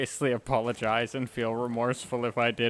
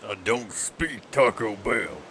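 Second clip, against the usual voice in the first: comic dub line; 4 semitones lower than usual. Retold or imitated. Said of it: "the one Monty comic that I dubbed was".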